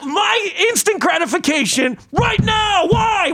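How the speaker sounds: high, worked-up comic tirade; heated rant. Acted. Cyclist rants about bad drivers, heated tirade. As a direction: rant at them in exasperation.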